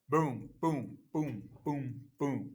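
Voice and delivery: "Boom, boom" thumped loud like a drum; mock drumbeat booms. Shown as a demonstration. Teacher thumping the rhythm for students. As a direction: beat out each boom. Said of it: "Hitting you".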